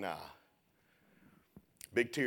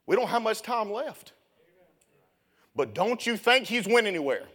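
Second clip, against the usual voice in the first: much higher and louder, shouted revival preaching, slowing on key lines; full-force preaching; 14 dB louder, slower than usual. Acted. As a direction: preach it out to the back row. Preaching climax to church.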